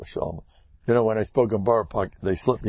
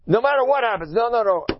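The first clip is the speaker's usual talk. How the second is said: high, emphatic insistence; high-pitched defiant protest; insistent refusal; 11 semitones higher than usual. Acted. Keeps shouting down defeatism.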